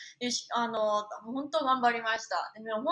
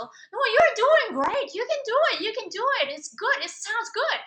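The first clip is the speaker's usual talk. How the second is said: kind encouraging coach; 10 semitones higher, 6 dB louder than usual. Retold or imitated. Coach Zed encouraging the struggling singer, high cheerful pep talk. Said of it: "But Zed is so kind".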